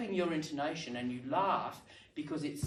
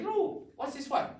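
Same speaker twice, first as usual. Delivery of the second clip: mock teacher question; 11 semitones higher, 3 dB louder than usual. Teacher role-playing a classroom question.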